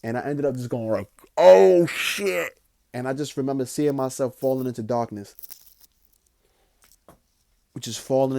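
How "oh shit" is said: higher and much louder, alarmed; startled cry. Retold or imitated. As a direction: blurt it out in shock. Seeing hands reach out.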